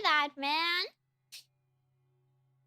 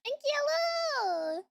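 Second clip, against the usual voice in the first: excited shout; 11 semitones higher, slower than usual. Real, not acted. Shouting hello to new raiders.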